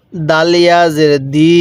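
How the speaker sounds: slow spelled reading with the long ই of দি held; one-alif stretch. Shown as a demonstration. Teacher drilling the children: slow held দি. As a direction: hold দি for one alif, slowly. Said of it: "এক আলিফ টান দিব".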